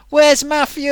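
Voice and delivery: high, loud mock-searching call; playful chant asking where he is; playful group call. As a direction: call out for him like a crowd. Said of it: "we should all say".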